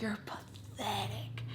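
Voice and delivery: slow, drawn-out whisper; hushed contempt. Retold or imitated. Daughter stopping mid-fight to address mom, contemptuous whisper. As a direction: drop to a whisper and stretch the insult. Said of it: "and I just stopped".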